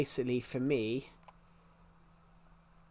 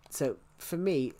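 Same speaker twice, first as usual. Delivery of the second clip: usual speaking voice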